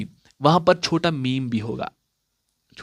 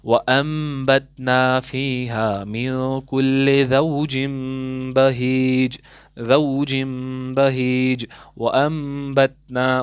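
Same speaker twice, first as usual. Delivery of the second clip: very slow recitation, nasal m drawn long; slow Quran recitation; much slower than usual. Shown as a demonstration. Teacher recites the verse as model for students, very slowly. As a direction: chant the verse slowly, stretching each ghunna. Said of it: "जिस तरीके से".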